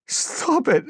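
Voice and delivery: very high, desperate scream; high-pitched cry to stop; screamed plea. A narrator's reading. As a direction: scream it in panic. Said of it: "he screamed".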